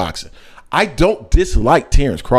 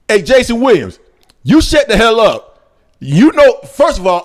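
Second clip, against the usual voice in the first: yelling back; 7 semitones higher, 4 dB louder than usual. Real, not acted. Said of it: "Jason, I clicked on A M P and all I hear is yelling".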